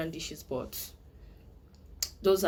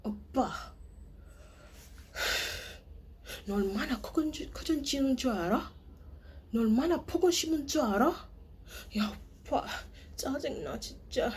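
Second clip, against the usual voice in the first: high, fast, pouty aegyo complaint; whiny girlfriend; 7 semitones higher, much faster than usual. Retold or imitated. Girlfriend complaining to her boyfriend in aegyo. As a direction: pout and whine at oppa. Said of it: "be like".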